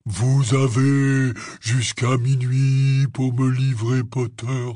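slow, drawn-out menacing proclamation; booming villain ultimatum. Retold or imitated. Hagrid quoting You-Know-Who's ultimatum to Harry, slow and menacing. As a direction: imitate a voice that carries, stretched out.

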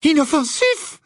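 loud, very high outburst; shrieking high. A narrator's reading. Hermione exclaims at Hagrid's claim, in a piercingly high voice. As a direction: shoot right up in disbelief. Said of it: "s'exclama Hermione d'une voix suraiguë".

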